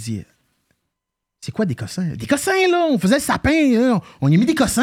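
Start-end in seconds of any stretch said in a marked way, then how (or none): 2.2-4.8 much higher-pitched retold explanation; imitated coworker. Acting out the answer to his question, high imitated voice. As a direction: mimic someone insisting on the obvious, pitched way up.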